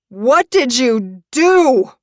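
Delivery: loud, drawn-out high scream. Acted. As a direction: scream it in horror, stretching the words. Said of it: "Brecken screams again".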